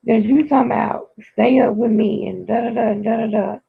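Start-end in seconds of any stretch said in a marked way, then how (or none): none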